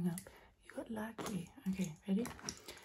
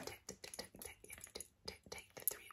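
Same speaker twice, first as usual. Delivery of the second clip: tingly asmr mouth sounds; 16 dB quieter than usual. Acted. Repeated whispered trigger word.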